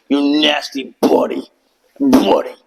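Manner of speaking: mocking drill instructor. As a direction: spit out the insult like a drill instructor. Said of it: "That's how they talk".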